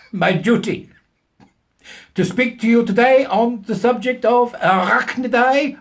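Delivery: mock German professor. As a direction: ham up a self-important German scientist. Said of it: "of German extraction".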